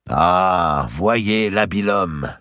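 slow, sneering mock praise; a hint of jeering delight; mocking exclamation. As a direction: rider jeering at the weeping king. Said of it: "s'écria Maltravers".

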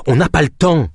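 mild high-pitched protest. A narrator's reading. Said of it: "protesta-t-elle".

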